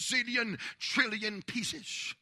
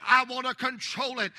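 ordinary delivery

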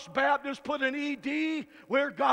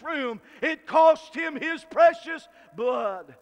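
loud, slow shouted preaching; preaching at full force; 3 semitones higher, 7 dB louder than usual. Acted. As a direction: hammer every line home to the pews.

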